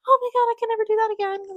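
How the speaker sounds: high, quick, teary wail of regret; mock panic. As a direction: squeal it out like a panicked heroine. Acting heroine's panic after kiss.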